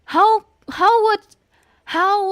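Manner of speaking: loud, high objection; heated protest. Real, not acted. Gamer arguing back at the game, loud and high. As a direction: insist with loud disbelief.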